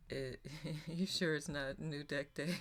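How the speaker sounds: ordinary delivery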